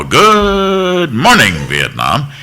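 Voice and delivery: very long, high GOOD, then a drop; yelled radio sign-on. Shown as a demonstration. DJ greeting troops on a bad day, yelling. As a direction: holler it like a DJ opening the show. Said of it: "that I would yell".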